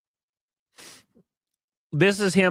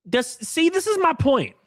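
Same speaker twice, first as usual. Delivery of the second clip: ordinary talk, nothing marked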